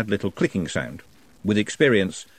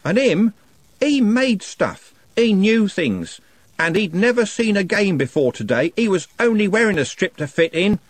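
anguished rage; 10 semitones higher, 4 dB louder than usual. A narrator's reading. Trev defending dead Nutt to the gang, anguished rage. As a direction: defend the dead friend in fury.

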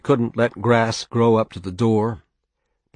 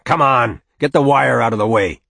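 loud, higher, barked command; impatient order; 4 semitones higher, 8 dB louder than usual. Acted. Father ordering the boy to open the gate, impatient shout. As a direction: order it loudly across the yard.

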